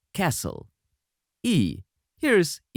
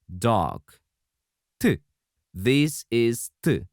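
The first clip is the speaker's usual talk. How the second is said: ordinary delivery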